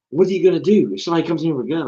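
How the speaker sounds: high, loud, rushed student voice; imitating alarmed girl. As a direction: blurt it like a panicking student. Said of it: "one of the girls was like".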